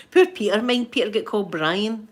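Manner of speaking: ordinary delivery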